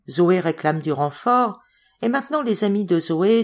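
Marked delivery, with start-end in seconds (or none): none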